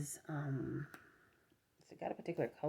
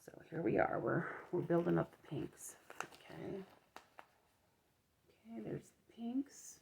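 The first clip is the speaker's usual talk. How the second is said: whispering; 2 semitones higher, 4 dB quieter than usual. Real, not acted. Admiring yarn softly.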